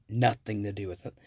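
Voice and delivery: normal talking voice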